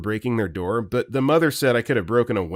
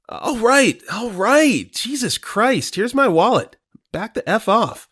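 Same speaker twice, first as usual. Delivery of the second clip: high, loud frantic pleading; panicked surrender; 11 semitones higher, 4 dB louder than usual. Acted. Ted surrendering his wallet, panicked high shouting. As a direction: hand it over in terror.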